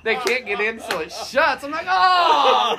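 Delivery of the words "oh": loud, high mock wail of dismay; hammed-up "oh" of despair; anguished groan. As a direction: cry out as hope slams shut. Reacts to door shutting on chain.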